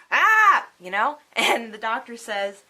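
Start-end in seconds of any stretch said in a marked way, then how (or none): 0.1-0.7 loud, high retold cry of alarm; exclaimed "ah". Patient reacting to the doctor's news, alarmed cry.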